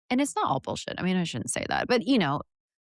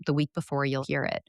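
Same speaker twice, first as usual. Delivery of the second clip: ordinary talk, nothing marked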